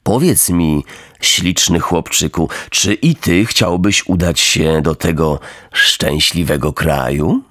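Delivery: low, sweetly wheedling coachman; fawning coachman. Acted. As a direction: coax him like a smarmy coachman. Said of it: "z wielkim przymilaniem".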